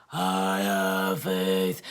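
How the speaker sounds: very low, drawn-out monster voice; mock demonic affirmation. Mocking her own pep talk, very low and drawn out. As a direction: drop far down and stretch each word.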